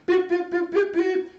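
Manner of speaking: high, loud beep beep; mock car horn.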